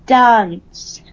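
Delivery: "Dance" whispered with a broad posh A; accent demo. Shown as a demonstration. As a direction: breathe out "Dance" like "dahnce". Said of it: "accordion stretched R's".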